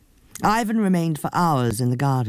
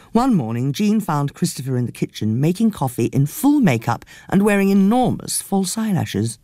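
ordinary delivery

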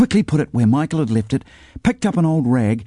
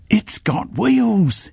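high, very slow excited cry; excited near-shout; 11 semitones higher, much slower than usual. A narrator's reading. Sean remembers the pram's wheels, excited. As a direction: burst out with a sudden idea. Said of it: "he almost shouted with excitement".

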